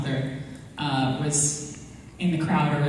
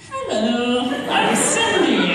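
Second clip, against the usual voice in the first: high, slowing mock-smitten hello; changed starstruck voice; 7 semitones higher, much slower than usual. Friend meeting the actor she has a thing for, changed starstruck voice. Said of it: "Her voice changed. She went like this".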